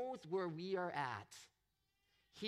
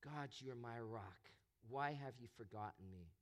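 much softer and lower, a broken cry to God; desperate plea; 7 semitones lower, 6 dB quieter than usual. Retold or imitated. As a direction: pray it quietly from the bottom of the pit. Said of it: "he's going".

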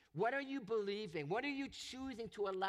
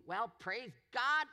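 louder, breezy fake cheer, a bit higher; mock cheerful piety; 4 semitones higher, 4 dB louder than usual. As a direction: sing out a smug hallelujah as if nothing hurts. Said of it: "he didn't sit back and say".

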